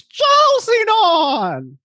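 slow, high, drawn-out announcement; announcer voice. Acted. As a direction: announce the name grandly. Show intro name announcement.

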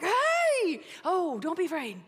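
high, bright, overexcited reassurance; calm them while bursting with joy; excited angel. Retold or imitated. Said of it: "and he's like".

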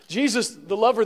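ordinary talk, nothing marked

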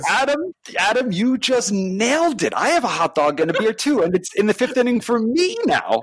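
high, thrilled gushing; excited. Real, not acted. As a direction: gush with real delight. Excited reply to the voicemail.

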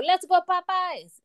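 a French crowd's cheer, stretched out; French accent. Retold or imitated. Imitating the French staff cheering at the ribbon cutting, French accent. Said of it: "I like the little French accent in there".

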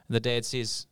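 usual speaking voice

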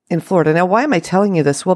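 ordinary talk

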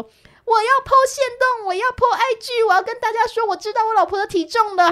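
high, loud mock bragging; gleeful shouting. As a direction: crow it out to the whole room. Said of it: "他就大声嚷嚷说". (丈夫得意地大声嚷嚷)

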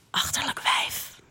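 slow, venomous whisper; voiceless, menacing hiss; whispered insult. Acted. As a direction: whisper it spitefully, drawn out. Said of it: "fluister ik er toe".

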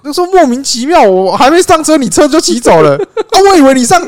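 high, loud exasperated retelling; indignant rant. Real, not acted. Complaining to a friend about mom, high and loud. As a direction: vent the outrage of being left. Ranting about being left behind.

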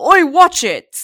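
loud, high "oi, watch it"; annoyed shout. Acted. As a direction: snap at a clumsy stranger. Bumped people yell at him.

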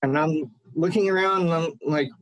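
ordinary talk

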